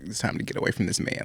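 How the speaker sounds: quick hushed whisper; grandmother's whisper. Retold or imitated. Grandmother's spirit warning her granddaughter, hushed quick whisper. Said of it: "My grandmother was like".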